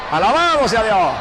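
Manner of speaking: preacher's loud joyful cry; shouted praise. Real, not acted. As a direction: praise God at full shout. Preacher's sudden praise outburst.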